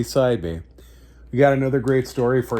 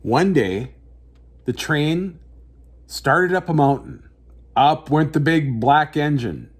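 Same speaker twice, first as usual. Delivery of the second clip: story narration; 4 semitones higher than usual. A narrator's reading. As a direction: tell it like a bedtime picture book. Reads picture book aloud.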